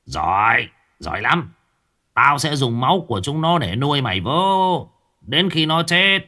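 put-on gleeful evil old sorcerer, voice climbing; cackling sorcerer. Sorcerer thầy Mo gloats to his henchman, cackling. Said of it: "Lão thầy Mo cười sằng sặc".

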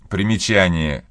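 usual speaking voice

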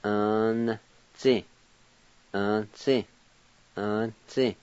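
"Un-zi" with the V silent and the N sounded. Shown as a demonstration. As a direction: say "Un-zi" with a clear N. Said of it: "pronounce the N sound like this".

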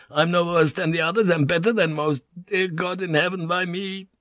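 high, anguished protest; anguished inward scream. A narrator's reading. Vink's mind pleading with God against death, anguished. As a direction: protest desperately, pitch held high. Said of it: "his brain screamed".

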